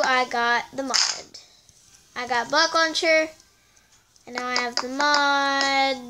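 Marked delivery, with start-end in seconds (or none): none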